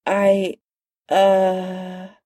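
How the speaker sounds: halting, stammered start; stammering. Acted. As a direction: stumble over the words. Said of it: "Banner stammered".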